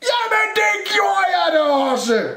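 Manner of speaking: very high, exasperated yell; grumpy shout. A narrator's reading. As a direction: shout it in cross protest. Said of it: "råbte Rumle surt". (råber surt)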